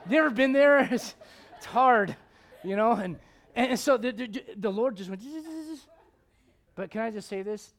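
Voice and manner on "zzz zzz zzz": quiet, high repeated buzzing; drill noise. Retold or imitated. Preacher shows what the Lord just did, drill noise. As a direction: imitate short bursts of a dentist's drill.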